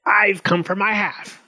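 high-pitched goblin demand; goblin's cry. A narrator's reading. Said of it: "he cried".

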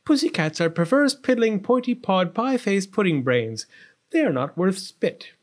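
spoiled, contemptuous tirade; scornful princess. A narrator's reading. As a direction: hurl insults at the cats. Princess insults cats.

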